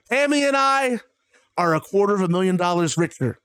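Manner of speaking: ordinary talk, nothing marked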